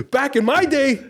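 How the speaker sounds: high-pitched, playful outrage; mock indignant old-timer. Griping to co-host that scammers were nicer, mock indignant. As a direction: complain in joking disbelief, pitch way up.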